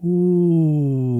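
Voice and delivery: "ooh" lifted and held on the exhale; sustained ooh. Shown as a demonstration. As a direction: float the ooh out gently. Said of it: "for the sound ooh".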